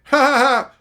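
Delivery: loud high canned sitcom laughter; mock laugh-track guffaw; fake laugh track. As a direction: mimic a studio audience laughing.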